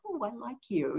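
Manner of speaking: quietly saying it for the snake; soft cooing. Retold or imitated. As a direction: coo it gently. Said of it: "It's like".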